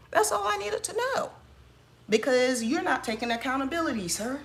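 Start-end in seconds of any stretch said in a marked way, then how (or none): 0.1-1.3 high, mocking sing-song; sarcastic sing-song. Repeated, pitched way up.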